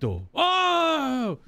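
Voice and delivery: high, loud hyped-up shout; a big mock-excited oh. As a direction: shout it like a delighted fan. Hyping the Japanese pronunciation.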